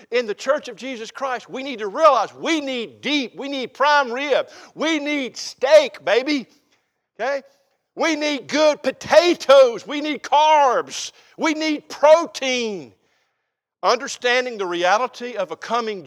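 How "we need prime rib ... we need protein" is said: slow, louder, each item punched out; emphatic preaching. Real, not acted. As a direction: hit every "we need" hard and slowly. Preacher hammering the point home.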